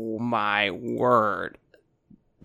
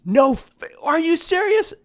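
much higher, louder outraged yell; bartender shouting; 14 semitones higher, 7 dB louder than usual. Acted. Bartender shouts at the fighting pirates, much higher, louder outraged yell. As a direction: shriek at the brawlers to read the sign. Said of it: "the bartender starts shouting".

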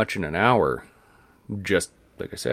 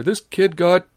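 high, loud excited call; old man calling his wife over, excited; 12 semitones higher, 8 dB louder, slower than usual. Retold or imitated. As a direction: shout the news across to his wife. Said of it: "he calls his wife over".

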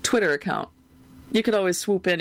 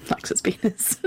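hushed whispered catchphrase; whispering; slower than usual. Pretend. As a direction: breathe it out as a whisper.

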